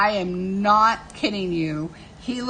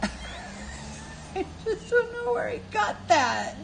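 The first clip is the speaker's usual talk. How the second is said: higher, laughing so hard it nearly sounds like crying; teary laughter; slower than usual. Real, not acted. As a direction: squeak it out through tears of laughter. Laughing until tears.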